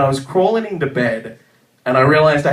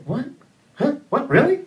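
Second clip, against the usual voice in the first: pitched-up what, really in shock; stunned disbelief; 9 semitones higher than usual. Retold or imitated. Retold shock at hearing his crush likes him. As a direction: caught completely off guard, voice jumping up.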